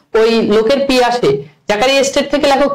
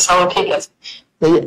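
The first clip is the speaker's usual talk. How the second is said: muffled repeat; 6 semitones lower than usual. Retold or imitated. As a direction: let it come back dull and distant, like an echo. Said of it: "আসলে কি সাউন্ডের problem?".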